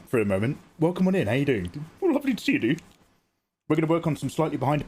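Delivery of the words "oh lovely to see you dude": excited greeting. Real, not acted.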